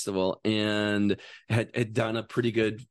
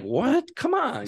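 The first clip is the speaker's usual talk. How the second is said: high, disbelieving objection; retold incredulous protest; 13 semitones higher, slower than usual. Jogger objecting to the guy's warning, incredulous.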